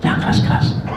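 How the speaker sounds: secretive whisper; hushed whisper. Retold or imitated. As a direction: whisper it so wife can't hear. Old man hushes friend.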